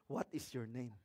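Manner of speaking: low, very soft question; calm reply. Pretend. Worker answering a shouting boss, low and calm.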